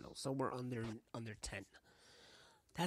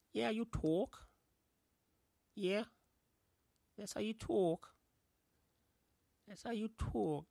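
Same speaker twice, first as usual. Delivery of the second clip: high, put-on New York voice; New York accent; 7 semitones higher, 3 dB louder than usual. Playing with how you talk, New York accent. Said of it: "Like that New York accent I did".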